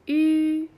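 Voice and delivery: "う" as a long, level Mandarin ü, loud and high; ü pronunciation demo. Shown as a demonstration. Teacher shows learners the lip shape, "う" drawn out as ü. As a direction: hold "う" steady with lips rounded. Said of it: "口の形で 発音してみてください".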